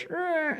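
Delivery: high, trilled ladder creak; creaking sound effect. Retold or imitated. Showing the ladder being pulled down, high creaking sound. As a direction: creak it out like an old hinge. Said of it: "and you do sound effects".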